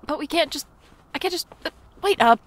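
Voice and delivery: high, loud flustered shouts; flustered call. Acted. Clerk running after her, shouting wait up. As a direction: chase her, calling out in a panic.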